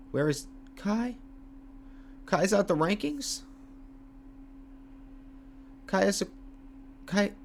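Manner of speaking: can't believe he dropped out of the rankings; shocked disbelief. Real, not acted. Kai missing from rankings.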